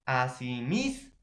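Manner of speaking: "asimismo" drawn out, leaning on "mis"; stress on MIS. Shown as a demonstration. Teacher showing students where the force falls, stress on "mis" in "asimismo". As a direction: split "asimismo" slowly, holding the syllable "mis". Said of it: "dónde lleva la mayor fuerza de voz".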